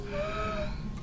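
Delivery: high startled gasp; sharp gasp. Shown as a demonstration. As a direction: gasp as if frightened. Acting mouse's fear.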